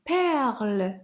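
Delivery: put-on "Perles" said once, clearly, as one word for children to repeat; model pronunciation. Shown as a demonstration. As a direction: say "Perles" clearly for kids to copy. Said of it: "Can you say perles?".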